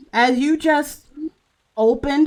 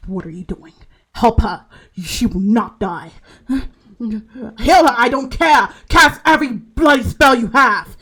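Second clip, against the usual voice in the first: father's command; 3 semitones higher, 5 dB louder than usual. Acted. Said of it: "you hear his voice saying".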